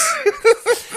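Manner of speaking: squeaky burst of laughter; high laugh. Real, not acted. Laughing at her own joke about burning the world. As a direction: crack up high.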